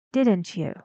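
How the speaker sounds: "Didn't you" stepping down; falling intonation. Shown as a demonstration. Teacher repeats the tag seeking agreement, falling "Didn't you".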